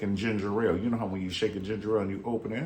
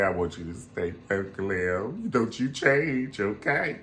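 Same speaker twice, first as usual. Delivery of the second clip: tender plea; slower than usual. Acted.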